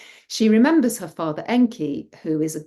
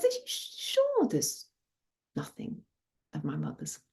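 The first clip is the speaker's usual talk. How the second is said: coaxing daughter; 9 semitones higher, 7 dB quieter than usual. A narrator's reading. Daughter to drinking father about mother's things, coaxing. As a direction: wheedle gently, sinking almost to a whisper.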